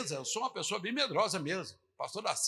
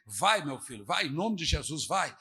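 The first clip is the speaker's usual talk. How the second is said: lower, gentle blessing; encouraging; 7 semitones lower than usual. Retold or imitated. Pastor encouraging a hesitant believer, low gentle voice. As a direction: send him off gently.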